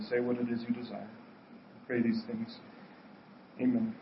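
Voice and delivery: quiet earnest prayer; pastoral prayer. Acted. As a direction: pray humbly over the people. Leads congregation in prayer.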